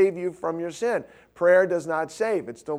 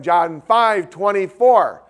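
slow, loud repeated call; repeating louder; 4 semitones higher, 9 dB louder, slower than usual. Retold or imitated. Friend repeating the verse to hard-of-hearing dad, slow loud call. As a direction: say it again slowly so a deaf man hears.

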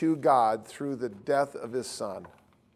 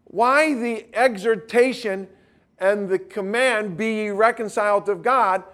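raised, loud preacher's exhortation; full-force preaching; 6 semitones higher, 7 dB louder than usual. Real, not acted. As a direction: urge the congregation, high and loud.

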